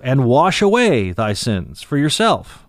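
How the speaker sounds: And wash away thy sins for yourself said slowly, leaning on wash away; slow emphatic reading. Shown as a demonstration. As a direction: spell out for yourself slowly to teach the point. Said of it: "is also in the middle voice".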